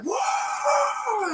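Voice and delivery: retelling the heroine firing a gun, high mock whoa. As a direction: squeal out a long whoa. Character's shout in retold scene.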